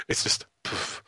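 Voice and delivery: soft breathy poof for a limp punch; weak poof. Retold or imitated. Mimicking the film's weak punch sound effect, a soft breathy poof. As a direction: puff it out like a feeble punch sound. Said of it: "sound effect of a really weak punch".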